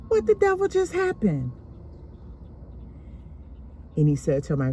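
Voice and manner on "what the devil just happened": much higher, shocked outburst; startled grandmother. Retold or imitated. Grandmother calling from the kitchen, shocked. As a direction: demand to know what just happened.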